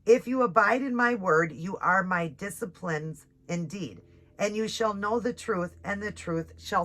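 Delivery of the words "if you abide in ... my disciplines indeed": ordinary talk, nothing marked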